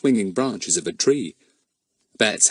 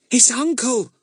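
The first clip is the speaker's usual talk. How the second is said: panicked gasp. A narrator's reading. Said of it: "gasped Ern in a panic".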